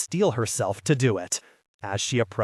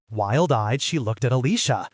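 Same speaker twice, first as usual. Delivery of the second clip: ordinary delivery, nothing marked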